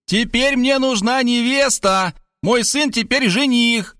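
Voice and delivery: high, slow mock yell; mother's high, stretched-out cry; full-force yelling. A narrator's reading. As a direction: proclaim it slowly in a very high voice. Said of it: "мать завопила изо всех сил".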